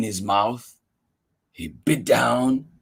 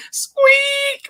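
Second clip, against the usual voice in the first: tiny high mouse squeak; 4 dB louder than usual. Acted. Mister Mouse squeaks to his friend. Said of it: "Mister Mouse says".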